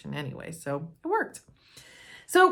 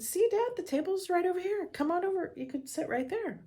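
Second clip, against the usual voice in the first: coaxing dad; 12 semitones higher than usual. Retold or imitated. Coaxing father to the table.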